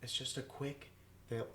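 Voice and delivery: hushed whisper. Real, not acted. Whispering to cohost before the prank call. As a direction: keep it secret while the phone rings. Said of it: "whisper unvoiced before prank call".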